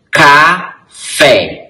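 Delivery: "Café" split as ca, pause, FÉ; stress on last syllable. Shown as a demonstration. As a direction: set the final "fé" of "Café" apart and stress it. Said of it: "ele é a sílaba mais forte". ("Café" separado, com força no fé)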